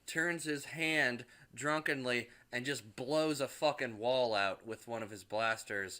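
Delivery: ordinary talk, nothing marked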